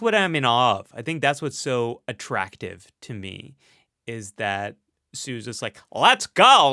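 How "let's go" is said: loud, high, hammed-up accent of a bold aviator; Amelia Earhart's imagined accent. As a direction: put on a badly faked accent, pitched up and loud. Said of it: "what I assume her accent sounds like".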